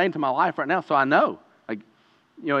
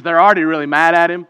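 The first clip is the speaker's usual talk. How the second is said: usual speaking voice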